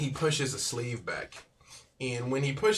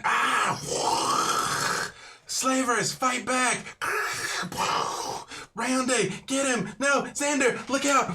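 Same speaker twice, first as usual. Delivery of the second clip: much higher and louder battle cries; Helene battle shout; 13 semitones higher, 5 dB louder than usual. Acted. Helene shouts to allies during the slaver attack, loud and high. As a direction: yell warnings across a fight. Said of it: "You hear Helene".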